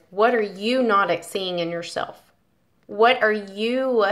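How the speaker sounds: ordinary talk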